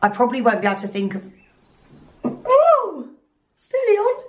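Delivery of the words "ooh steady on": very high startled ooh; surprised squeak. Real, not acted. Startled squeak, then laugh.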